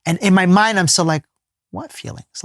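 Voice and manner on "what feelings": a much higher, soft unvoiced whisper of confusion; whispered inner thought. Answering her question in his head, whispered and baffled. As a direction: breathe it to yourself, baffled.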